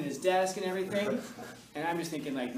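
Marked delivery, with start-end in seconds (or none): none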